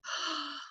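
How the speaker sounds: soft, high intake of breath; gasp. Retold or imitated. Woman reeling from her realization, soft high gasp. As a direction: gasp after the outburst.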